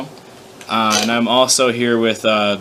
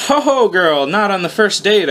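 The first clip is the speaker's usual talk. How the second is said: high, playful brush-off joke; joking; 7 semitones higher than usual. Acted. Narrator joking to the horse girl, high playful brush-off. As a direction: tease her with a high laugh in the voice. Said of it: "I joked".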